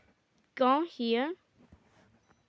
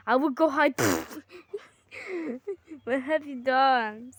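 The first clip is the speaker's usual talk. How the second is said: ordinary talk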